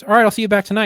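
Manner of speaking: lightly voiced offhand boss; casual lieutenant. Lieutenant brushing off a shaken officer, offhand. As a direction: toss it off like a boss who doesn't care. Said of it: "The lieutenant looks at me and goes".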